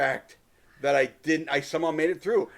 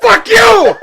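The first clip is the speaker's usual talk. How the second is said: exasperated high, loud rant; yelling; 11 semitones higher, 11 dB louder than usual. Real, not acted. Co-host berating his friend for not hating the movie, yelling. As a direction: shout it helplessly, at the top of your range. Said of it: "I'm yelling".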